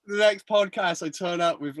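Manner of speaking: ordinary talk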